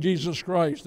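ordinary talk

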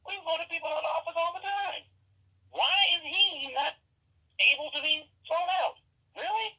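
mock outrage, pitched far up; incredulous. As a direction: sound stunned that anyone believes it. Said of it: "I'm like".

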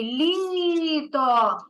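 Літо with the first syllable Лі drawn out and pushed; stress demo. Shown as a demonstration. Teacher showing a child where the stress falls, stretching Лі. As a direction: lean hard on Лі in Літо, let то fall away. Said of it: "На перший чи другий?".

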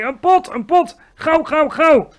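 high, very loud, pressing demand; urgent shout. A narrator's reading. Giant needs a pot from Sophie, urgent shout. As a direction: bellow for help in a rush. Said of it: "riep hij".

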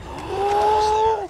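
high startled gasp; sharp excited gasp. Real, not acted. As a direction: gasp in disbelief. Gasp at a big play.

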